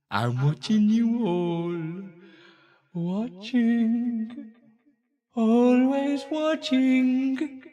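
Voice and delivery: slow, stretched-out eerie moon; drawn-out all-seeing moon voice; creepy watching moon. Acted. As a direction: let each word hang, watching everyone. Moon character watches the chat.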